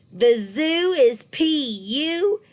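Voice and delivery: high, loud, slow shout; silly shout. A narrator's reading. Polly Allen Puffer yelling a silly rhyme, loud shout. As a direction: shout the rhyme at his loudest. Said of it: "he shouted at his loudest".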